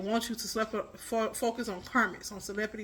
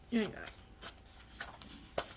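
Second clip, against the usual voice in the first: slow breathy whisper; 12 dB quieter, much slower than usual. Real, not acted. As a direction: whisper it under your breath slowly. Repeats retort under breath.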